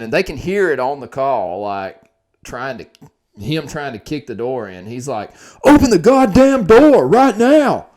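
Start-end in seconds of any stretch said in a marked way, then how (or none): 5.6-7.9 much higher, louder imitated bellow; imitated screaming. Crazed man kicking in the door, imitated screaming. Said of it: "he's screaming at the top of his lungs".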